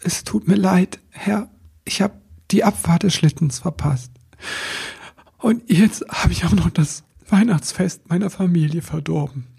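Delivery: small, sorry little elf; apologetic elf voice. A narrator's reading. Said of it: "Er hielt den Kopf gesenkt und sprach".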